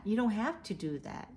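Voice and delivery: ordinary delivery, nothing marked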